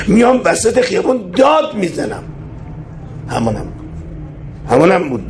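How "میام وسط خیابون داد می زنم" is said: defiant declaration. Retold or imitated. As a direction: pitch leaps up on the vow. Quoted imam vows to shout.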